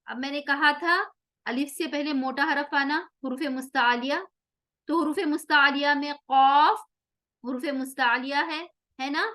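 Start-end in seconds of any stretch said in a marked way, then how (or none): none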